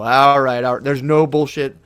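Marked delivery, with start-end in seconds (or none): 0.0-0.8 louder, resigned all right all right. Retold or imitated. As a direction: accept the scale's verdict out loud. Stepping on the scale.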